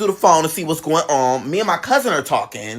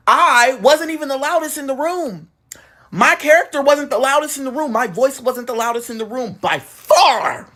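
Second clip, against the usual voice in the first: high, punched "loudest in the room"; emphatic declaration; 6 semitones higher than usual. Real, not acted. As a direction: drive each phrase home, stretching "far". Emphatic repeated point about being loudest.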